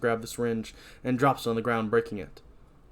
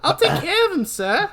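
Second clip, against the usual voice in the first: high, loud New York voice; New Yorker; 6 dB louder than usual. Acted. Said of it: "This is my voice now".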